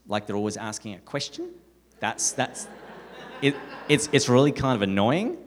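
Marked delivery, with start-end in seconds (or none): 0.1-1.5 Australian-style upturn on "question"; rising question tune. Shown as a demonstration. Explaining Australian speech to Americans, with a questioning rise on "question". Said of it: "go up at the end of every sentence". 4.1-5.4 mock Australian upspeak landing on "annoying"; rising question tune. Shown as a demonstration. Mocking Australian speech, with a big questioning rise on "annoying". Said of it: "go up at the end of every sentence".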